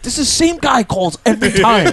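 high, worked-up imitation of an angry boss; mock angry manager. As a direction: imitate an exasperated man, pitch jumping up. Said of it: "he got really mad. He got really upset".

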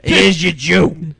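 retold waitress.

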